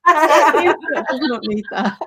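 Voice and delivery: slow and loud, laughing through it; foghorn voice. Real, not acted. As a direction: boom it out like a foghorn with a giggle. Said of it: "I sound like a foghorn".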